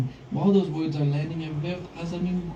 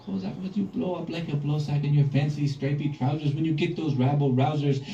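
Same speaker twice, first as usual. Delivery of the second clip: ordinary delivery, nothing marked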